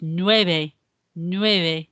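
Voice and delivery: "nueve" with a subtle b, not a v; gentle b sound in the middle of "nueve"; soft b in nueve. Shown as a demonstration. Teacher models "nueve" with a soft b for pupils. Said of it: "more of a B sound".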